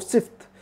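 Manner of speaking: sift with F and T run together; mispronunciation. Shown as a demonstration. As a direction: end सिफ्त on FT, no vowel between. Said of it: "सिफ्त नहीं, सिफत".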